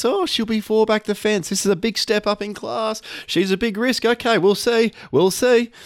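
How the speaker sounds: high, cautious mock Brad; imitating Brad. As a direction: pitch it up, doubtful and hedging. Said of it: "Brad will be telling us".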